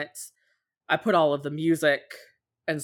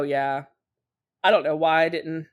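usual speaking voice

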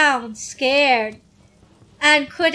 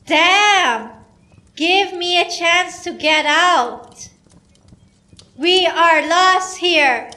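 shouting; 3 semitones higher than usual. Retold or imitated. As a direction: yell it out, frustrated and lost. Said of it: "After I shouted that".

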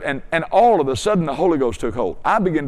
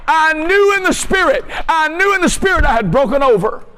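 high, driving preacher's holler; fired-up pulpit delivery, sustained high pitch; full-force preaching; 10 semitones higher than usual. Acted. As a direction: re-enact a defiant showdown with the devil, voice pitched high.

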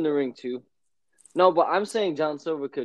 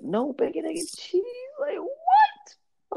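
indignant disbelief; slower than usual. Retold or imitated. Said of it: "I was tight".

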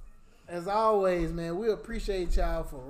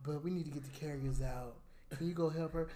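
put-on gently ask, like a seventy-year-old boss; calm old supervisor; 6 semitones lower, 11 dB quieter than usual. Elderly supervisor calmly asks favor.

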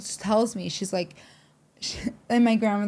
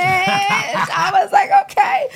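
high laughing shout; laughing squeal; 10 semitones higher, 5 dB louder, slower than usual. Real, not acted. Laughing retelling of night one.

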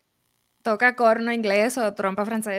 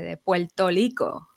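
slow Puerto Rico with an L, "Puerto Rico" as "pwelto liko"; mock accent joke; 2 semitones lower, slower than usual. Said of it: "me hacen el chiste". (acento boricua exagerado, "Puerto Rico" como "pwelto liko")

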